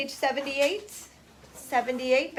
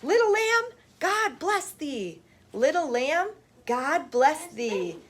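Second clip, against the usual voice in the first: excited recitation; 4 semitones higher, slower than usual. Shown as a demonstration. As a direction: show the class excitement in the voice. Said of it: "so we should be excited".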